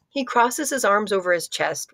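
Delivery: plain reading voice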